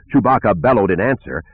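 ordinary delivery, nothing marked

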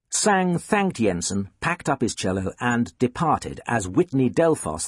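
ordinary delivery, nothing marked

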